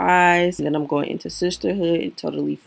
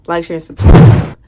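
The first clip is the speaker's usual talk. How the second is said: loud, fast YouTuber outro shout; loud call to subscribe; 12 dB louder than usual. Real, not acted. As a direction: belt out the subscribe plea. Shouting the usual outro plea.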